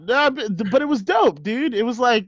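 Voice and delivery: high, overselling dude voice; squeaky nervous bro; high flustered bro. Acted.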